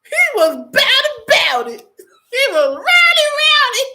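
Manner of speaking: high laughing through tears; laughing disbelief. Real, not acted. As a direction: howl with laughter at the number. Cracking up over the guard.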